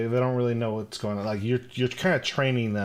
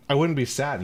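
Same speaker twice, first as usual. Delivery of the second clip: ordinary talk, nothing marked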